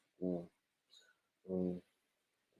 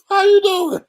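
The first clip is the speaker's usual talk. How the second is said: laughing; 9 dB louder than usual. Real, not acted. Greeting pastor, laughing.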